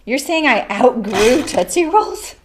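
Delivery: slow, drawn-out mock indignation; mock offense. Teasing a viewer who called her taste grown-up, mock offended. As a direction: pretend to be insulted by the viewer.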